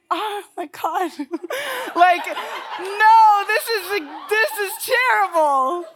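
high, loud, laughing through every word; real laughter spilling into the words. Real, not acted. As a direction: crack up while you say it. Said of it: "I can't even say this without laughing".

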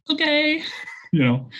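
sing-out, very high falsetto okay; happy mom. Retold or imitated. Delighted mom agreeing on the phone, imitated in high falsetto. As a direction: squeal it sweetly like a delighted mother. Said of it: "she was so happy".

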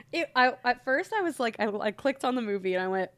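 ordinary talk, nothing marked

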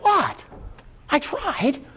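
high, hurt little objection; wounded protest. Acted. Peter answers the rebuke, feebly protesting.

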